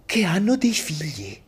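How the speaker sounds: whispered punchline. Acted.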